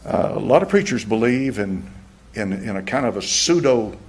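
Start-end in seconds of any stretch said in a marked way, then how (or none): none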